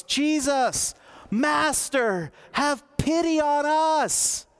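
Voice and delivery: high, drawn-out cry for pity; loud calling out. Acted. Lepers calling to Jesus from a distance, high loud plea. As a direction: call out loudly, stretching the last words. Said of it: "they called out in a loud voice".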